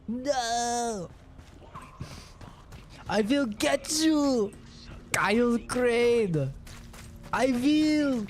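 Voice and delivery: high, slow mock German accent for the Baron; a clumsy Arnold-style German accent; bad German accent. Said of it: "I have no idea how to do a fucking German accent".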